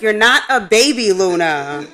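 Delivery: loud, playful telling-off of a dog; mock scolding. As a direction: correct the dog firmly but fondly.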